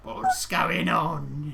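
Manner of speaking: put-on slow creaky elderly voice; grumpy old man. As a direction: croak it out slowly like an old man.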